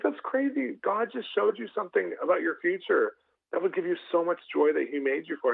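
high-pitched amazement that calms down; excited. Retold or imitated. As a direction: burst out thrilled, then settle. Pastor thrilled by girl's answer.

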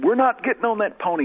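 high-pitched mock child's protest; mock scream. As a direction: protest like a frightened boy, half-hearted. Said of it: "And I started screaming".

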